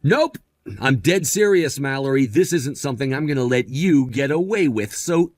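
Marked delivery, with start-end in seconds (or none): 0.0-0.4 high-pitched, curt refusal; defiant refusal. Acted. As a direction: shut the request down at once. Husband refuses the divorce.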